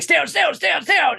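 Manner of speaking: urgent repeated stay out call; urgent spotter call. Retold or imitated. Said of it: "He's like".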